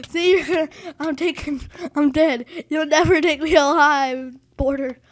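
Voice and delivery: loud, hammed-up defiant outburst; mock-heroic dying shout; mock defiance. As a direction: yell playfully at the thing that beat you. Mock defiance while dying in game.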